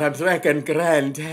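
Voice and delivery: hammed-up Irish Jamaican accent. Shown as a demonstration. Host hams his accent for the listeners. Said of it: "That's right, folks".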